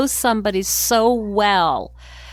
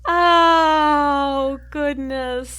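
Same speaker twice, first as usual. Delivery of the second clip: laughing exclamation; 8 semitones higher, much slower than usual. Real, not acted. Host reacts to the story.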